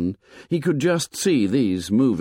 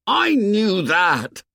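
high, loud and slow defensive shout; stung chief shouting at Piggy; 12 semitones higher, 4 dB louder, slower than usual. A narrator's reading. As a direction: snap back at Piggy, much higher. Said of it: "shouted Ralph".

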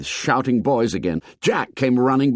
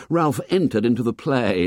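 ordinary delivery, nothing marked

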